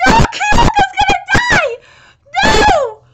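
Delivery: high, loud wail of protest; anguished scream. Real, not acted. Reader fears a character will die, anguished scream. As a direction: cry out in fan despair.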